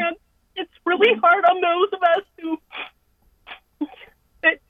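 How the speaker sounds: real crying, struggling to speak. Real, not acted. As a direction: voice breaking with tears. Caller breaks down over mother.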